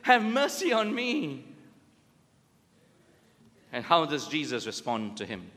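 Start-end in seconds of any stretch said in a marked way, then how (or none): 0.0-1.4 higher, slow, heartfelt plea; earnest plea. Retold or imitated. Blind beggar pleading to Jesus for healing, earnest slow cry. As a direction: beg slowly for real mercy. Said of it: "he says".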